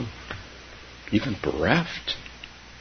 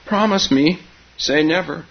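put-on high, louder childish demand; pleading; 10 semitones higher, 5 dB louder than usual. A narrator's reading. Keza begging silent Grandma for a promise, pleading. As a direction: press for the promise, high and insistent. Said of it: "pleaded Keza".

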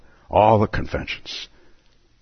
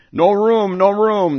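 high, loud cry of no room; cried out; 12 semitones higher, 4 dB louder, slower than usual. Acted. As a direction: shout it high like mad party guests. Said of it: "they cried out".

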